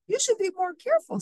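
high, quick scolding; mildly acted storybook crossness; cross. A narrator's reading. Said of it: "said Fox crossly".

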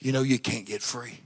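the accuser taunting a churchgoer, softly; the enemy's taunting voice. Acted. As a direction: hiss a soft taunt like the devil in their ear. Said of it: "That's what it'll tell you".

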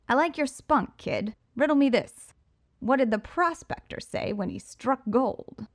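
high, cocky villain voice; Riddler voice. Acted. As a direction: play the smug showman.

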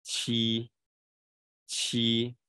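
七 held long, q gliding over a relaxed tongue; drawn-out, clearly articulated 七; air over tongue surface. Shown as a demonstration. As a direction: model 七 slowly and evenly. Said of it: "空气在舌面上划过".

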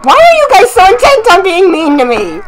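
high-pitched protest, falling as it goes; mock-aggrieved whine. Killer complaining that players are mean, mock-aggrieved whine. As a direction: complain like the wronged party.

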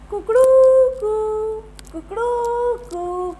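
high, loud cock-a-doodle-doo; rooster crow. Shown as a demonstration. Teacher shows kids the rooster's crow. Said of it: "मुर्गा ऐसे आवाज़ निकालता है ना".

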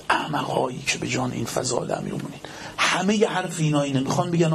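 ordinary talk

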